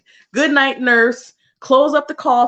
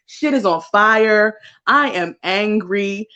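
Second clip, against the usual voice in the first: normal talking voice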